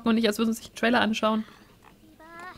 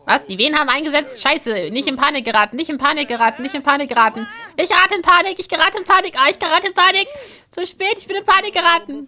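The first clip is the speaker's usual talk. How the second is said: loud, higher mock panic; hammed-up panic; 6 semitones higher, 7 dB louder, faster than usual. Husband Sim panicking over the contractions, loud and high. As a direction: spiral into comic panic.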